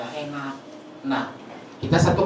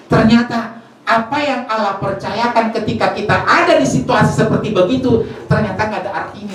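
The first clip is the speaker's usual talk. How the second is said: louder, higher preaching build; 6 semitones higher, 5 dB louder than usual. Acted. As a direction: preach it hard to the congregation. Preacher stressing life's meaninglessness.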